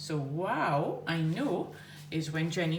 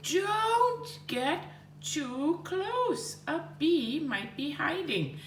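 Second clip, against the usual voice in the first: 1 mock shout; 10 semitones higher, much slower than usual. Shown as a demonstration. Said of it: "Bob shouted".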